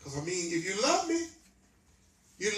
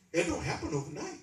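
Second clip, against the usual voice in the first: higher, louder preacher's call to the congregation; hammering the message with rising fervour; preaching at full force; 4 semitones higher, 3 dB louder than usual. Acted. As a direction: drive the sermon home to the pews.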